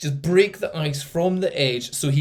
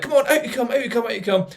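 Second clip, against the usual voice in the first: calling the dog; 7 semitones higher, faster than usual. Retold or imitated. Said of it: "And I was like".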